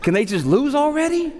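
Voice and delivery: high, laughing mock complaint; mock whine. Tired dad at six-year-old soccer, high laughing whine. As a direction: whine it half-joking.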